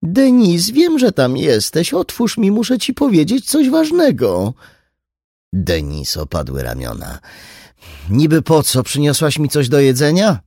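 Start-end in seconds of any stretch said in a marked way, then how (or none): 0.0-4.5 pleading mother. Acted. 8.1-10.4 louder, higher sullen retort; calling back. Acted. Daughter calling back through the door to her mother, sullen and loud. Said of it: "zawołała".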